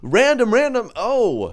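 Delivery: high loud drawn-out impression; [name] impression. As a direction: ham up a celebrity impression. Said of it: "[name] is like".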